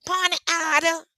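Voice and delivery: high-pitched mocking imitation; shrill-pitched mock objection; Candace. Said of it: "That's the other one, Candace".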